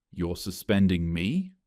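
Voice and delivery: usual speaking voice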